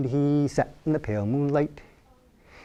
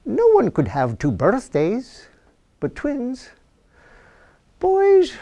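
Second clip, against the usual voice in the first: priest Father Mulcahy; 10 semitones higher, slower than usual. Acted.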